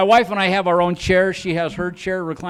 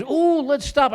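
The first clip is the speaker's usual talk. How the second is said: excited wife; 10 semitones higher, slower than usual. Retold or imitated. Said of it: "And she goes".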